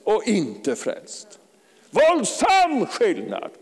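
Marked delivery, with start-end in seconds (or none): 1.9-3.5 high, loud, drawn-out preaching shout; emphatic high-pitched yell from the pulpit; real shout. Real, not acted. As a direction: cry it out at full force, stretching each word. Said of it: "jag ber inte om ursäkt att jag skriker".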